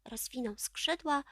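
usual speaking voice

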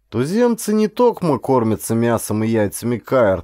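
ordinary delivery, nothing marked

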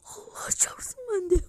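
breathless whisper of disbelief; excited whisper. Real, not acted. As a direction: whisper it in awe. Hushed after the shouting. (hayretle fısıldayarak)

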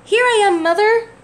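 high, loud call to the sky. Acted. Baby bird calling to the plane, high and loud. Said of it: "he called out".

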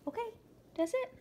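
high, quick sing-song wrap-up; playful end of treats; high cheerful. Real, not acted. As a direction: chirp it to the dogs. Ending treat time.

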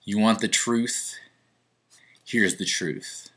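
low, slow, deliberate delivery; solemn confession. Pretend. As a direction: build gravely to the big reveal.

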